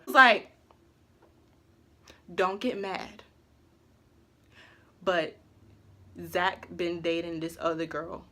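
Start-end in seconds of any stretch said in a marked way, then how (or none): 2.3-8.2 softly breaking bad news, much quieter; hushed. Friend carefully telling Christina about Zach, hushed. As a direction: lower your voice to break it gently.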